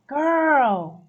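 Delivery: normal talking voice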